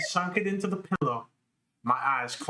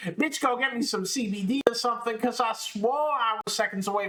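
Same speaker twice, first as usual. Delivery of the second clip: hammed-up excitement; 7 semitones higher than usual. Hammed-up narrator aside.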